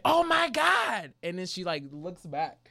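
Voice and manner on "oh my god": high, drawn-out mock exasperation; exasperated. Teller mocking the driver's slow high exasperation. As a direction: drag it out high like she can't believe it. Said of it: "And then she's like".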